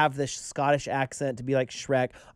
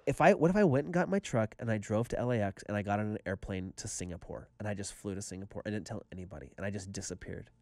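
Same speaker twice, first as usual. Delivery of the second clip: low voice fading very soft; hushed daydream; 8 semitones lower, 7 dB quieter than usual. Retold or imitated. Man imagining fleeing to Singapore, low and fading. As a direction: trail down secretively as the escape unfolds. Daydream of slipping away unnoticed.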